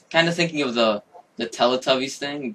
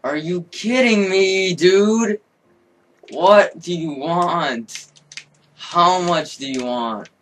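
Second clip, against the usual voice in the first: high, slow exasperated pleading; exasperated disbelief; 5 semitones higher, 4 dB louder, slower than usual. Real, not acted. Gamer pleading with the game, slow high exasperation. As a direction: drag out the frustration.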